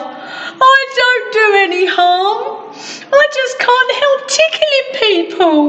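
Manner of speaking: a hint of a sad Mister Tickle; lightly voiced Mister Tickle. Mister Tickle pleads to himself, mildly plaintive. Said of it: "moaned Mister Tickle".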